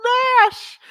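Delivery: loud, high surprised call; high yelp of a name. Real, not acted. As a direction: shout the name out in delight. Calling out a viewer's name.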